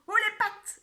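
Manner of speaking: high, loud mock stick-up yell; imitating Billy's shout. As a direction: replay the hold-up cry. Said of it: "et il a dit".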